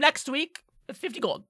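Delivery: high-pitched craftsman voice; haggling tinkerer. Acted. Tinkerer names his price.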